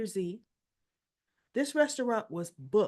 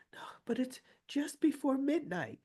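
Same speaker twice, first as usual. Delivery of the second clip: weak whisper; 6 semitones higher than usual. Retold or imitated. Retold reply of faint woman.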